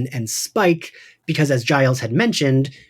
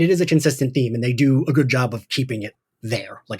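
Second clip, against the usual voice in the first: normal talking voice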